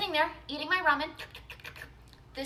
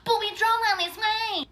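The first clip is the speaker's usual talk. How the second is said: high mocking customer voice; mock her complaint; lightly whiny customer voice; 10 semitones higher, 6 dB louder than usual.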